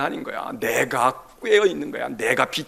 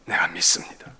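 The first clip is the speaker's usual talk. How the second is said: whispered confession; 9 semitones lower than usual. Acted. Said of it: "소리를 크게 질렀다는 거야".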